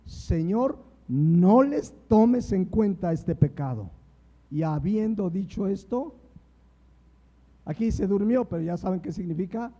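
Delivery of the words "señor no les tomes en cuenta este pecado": loud, slow plea to the Lord; crying out loud. Acted. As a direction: call it out with a great voice, slow. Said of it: "clamó a gran voz".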